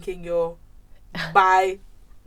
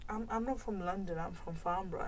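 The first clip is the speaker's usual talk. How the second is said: quiet reply; 3 semitones lower, 13 dB quieter than usual. Retold or imitated. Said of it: "And I'm like".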